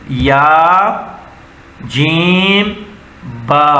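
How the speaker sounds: یا, جیم, با each held apart, جیم lifted; teacher modelling letter names slowly; slow letter-by-letter reading. Shown as a demonstration. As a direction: sound out the letters one by one. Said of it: "اب کیسے پڑھیں گے".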